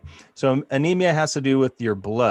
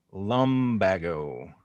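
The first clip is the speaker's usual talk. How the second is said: Lumbago stretched slowly, voice dropping lower; slow and drawn out; 5 semitones lower, 4 dB quieter, much slower than usual. Shown as a demonstration. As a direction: drag out Lumbago quietly.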